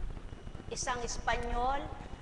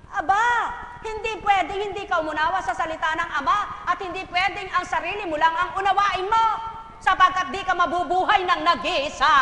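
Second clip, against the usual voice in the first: louder, rising rebuke; forceful preaching; 4 semitones higher, 8 dB louder than usual. Real, not acted. Preacher scolds the congregation, forceful and loud. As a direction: press the point hard.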